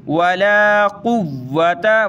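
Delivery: "कुव्वता" with the doubled w held firmly; hard doubled waw. Shown as a demonstration. Teacher showing tashdeed, "कुव्वता" with a firm held w. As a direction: lean on the middle consonant of "कुव्वता". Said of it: "इसको सख्त करेंगे".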